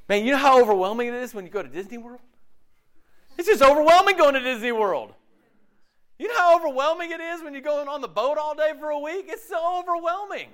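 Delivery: high-pitched, hammed-up whining about trivial hardships; mock complaining. Preacher mocking people overwhelmed by leisure, mock complaint. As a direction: lampoon a self-pitying complainer.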